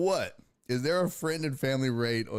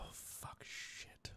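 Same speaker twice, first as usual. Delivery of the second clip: voiceless, defeated swearing; whispered cursing; 20 dB quieter, much slower than usual. Real, not acted. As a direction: curse under your breath. Swears to himself, alerts broken.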